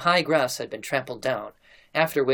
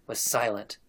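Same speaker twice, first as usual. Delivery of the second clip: ordinary delivery, nothing marked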